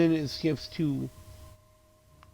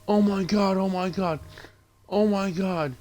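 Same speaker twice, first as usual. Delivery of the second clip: mock panic; 6 semitones higher than usual. Friends see her shot, mock horrified panic. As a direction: act out horrified shock in a high voice.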